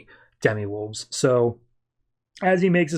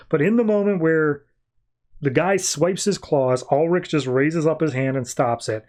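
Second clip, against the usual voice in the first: normal talking voice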